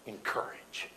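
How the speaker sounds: soft, breathy close; whispered ending. Real, not acted. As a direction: let it fall to a whisper. Closing words whispered.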